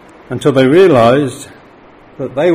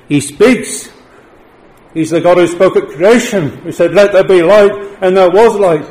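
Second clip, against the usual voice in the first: raised, higher preaching voice; 5 semitones higher, 4 dB louder than usual. Acted. Preacher urging congregation to repent, forceful preaching. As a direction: thunder the call to repent.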